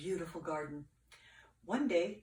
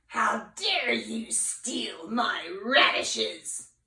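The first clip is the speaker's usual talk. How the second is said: slow, loud witch scolding; angry witch voice; shouting witch; 2 semitones higher, 7 dB louder, much slower than usual. Acted. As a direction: drag out each word at the thief. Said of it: "she shouted".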